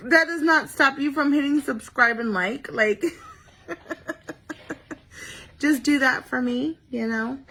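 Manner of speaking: high, breezy "I'm busy" excuse voice, then playful scolding; mock whiny excuse. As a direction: imitate fans brushing it off, then tease them to subscribe. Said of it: "And everybody's like".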